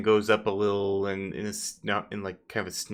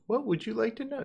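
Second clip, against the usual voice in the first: much higher, eager servile voice; ass-kissing yes man; 14 semitones higher, 4 dB quieter than usual. Acted. Clarence greeting the party as a yes man. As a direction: fawn over the guests. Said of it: "Clarence comes in and".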